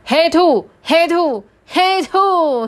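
dad yelling to catch the son; dad shouting. Acted. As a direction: shout each word like catching him out. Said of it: "爸比大喊说".

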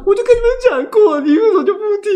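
high, loud frustrated yelling; exasperated yell. Retold or imitated. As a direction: cry out in frustration at family. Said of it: "说". (委屈又气愤地大喊)

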